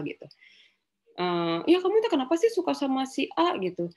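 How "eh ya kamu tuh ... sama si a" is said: mock questioning mother. Parent questioning child in retold dialogue.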